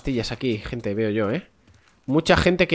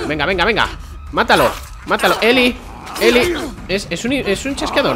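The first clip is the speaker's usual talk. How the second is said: high, loud urgent calls to the ally mid-fight; higher, louder frantic cheering; excited yelling; 7 semitones higher, 4 dB louder than usual. Real, not acted. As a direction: shout her on, panicked and thrilled. Urging ally during a fight.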